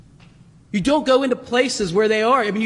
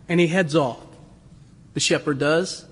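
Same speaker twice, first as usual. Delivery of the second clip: ordinary talk, nothing marked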